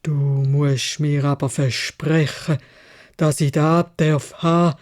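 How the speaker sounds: tiefes, langsames Krächzen des Biests; krächzend. Acted. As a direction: lass das Ungeheuer gnädig, aber schwer krächzen. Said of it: "krächzt". (Biest stellt krächzend seine Bedingung)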